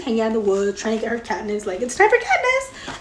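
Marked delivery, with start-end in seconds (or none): 1.8-3.0 mock shriek. Snow hunting her, mocked.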